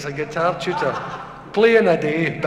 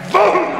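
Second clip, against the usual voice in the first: loud, high-pitched popping boom; imitated bang; 11 semitones higher, 6 dB louder than usual. Ear wax bursting out, loud imitated boom. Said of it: "And the wax went".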